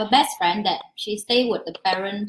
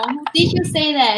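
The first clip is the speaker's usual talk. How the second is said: mock surprise; 6 semitones higher, 4 dB louder than usual. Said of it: "I'm like".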